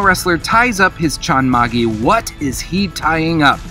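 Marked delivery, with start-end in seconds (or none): none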